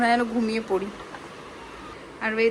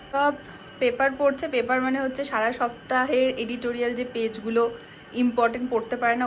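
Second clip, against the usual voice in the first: ordinary talk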